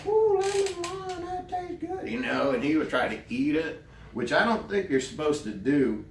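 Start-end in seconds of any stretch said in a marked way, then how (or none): 0.1-2.1 very high squeaky excited voice; excited Stephen. Retold or imitated. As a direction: squeal it in delight, far above your own pitch. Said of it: "And Stephen like".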